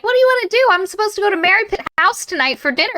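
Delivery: high, chirpy put-on voice; quick, high-voiced eager invitation; Henry Baskerville. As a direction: pitch it way up, hurrying eagerly.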